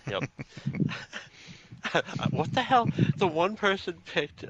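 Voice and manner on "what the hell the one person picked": high-pitched what the hell; surprised disbelief. Real, not acted. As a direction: rise way up in disbelief. Laughing disbelief.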